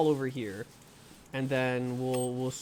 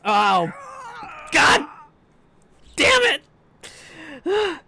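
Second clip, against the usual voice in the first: very loud, high outburst, broken by pauses; real screamed curse at losing; frustrated scream; 12 semitones higher, 8 dB louder, much slower than usual. Real, not acted. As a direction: yell each word in exasperation. Dies in game, yells in frustration.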